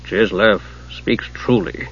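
understated, measured and slow; god's quiet admission. A narrator's reading.

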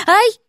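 sudden high-pitched "ow"; yelp of pain. A narrator's reading. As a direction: yelp as if just bitten. Паук укусил Полю. (вскрикнув от боли)

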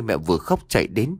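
plain reading voice

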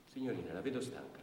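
ordinary talk, nothing marked

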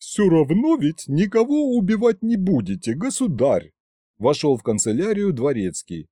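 lightly voiced complaining butler; grumbling butler. A narrator's reading. Butler interrupting the tsar, grumbling.

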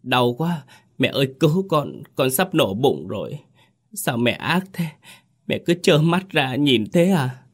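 high, pleading crying voice; wailing pitifully. A narrator's reading. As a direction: sob and beg mother for help, mildly. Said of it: "khóc lóc thảm thiết". (khóc lóc thảm thiết gọi mẹ)

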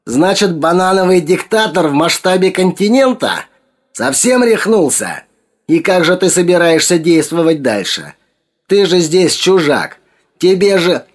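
high-pitched, indignant rant; shrill interruption. A narrator's reading. Max cuts Sergei off, shrill and outraged. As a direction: cut in shrilly, voice pushed high. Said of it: "Макс визгливо перебил его".

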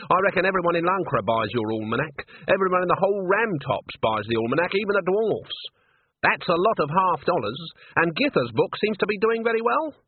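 pointed reckoning, rising in pitch; shrewd witch Granny. Acted.

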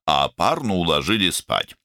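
ordinary delivery

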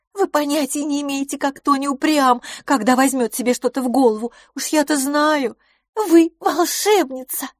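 high-pitched rapturous insistence; excited gushing. A narrator's reading. Miss LaFosse insists her friend is magical, gushing. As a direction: gush high, drawing out the final praise. Overjoyed friend gushes about the matchmaker.

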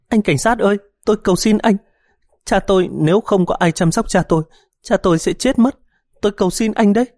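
high, wailing plea through tears; sobbing plea. A narrator's reading. Daughter begging the policeman, high tearful plea. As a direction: beg him tearfully, voice cracking high. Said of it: "bật khóc nức nở".

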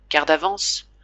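ordinary delivery

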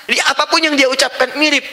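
ordinary talk, nothing marked

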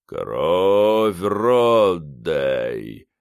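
deep, slow otherworldly rumble; dark lord. A narrator's reading. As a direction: rumble it like a voice from the grave. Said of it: "продолжал завывать замогильный голос".

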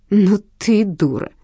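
slow, emphatic scorn; with feeling. A narrator's reading. Detective berating Lena, slow emphatic scorn. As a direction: lay it on heavily. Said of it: "проговорила я с чувством".

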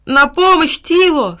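high, loud call for help; desperate cry. A narrator's reading. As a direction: shout for the dog to rescue you. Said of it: "снова закричал Тильтиль".

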